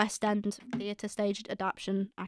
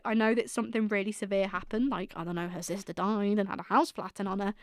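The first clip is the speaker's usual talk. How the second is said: ordinary talk, nothing marked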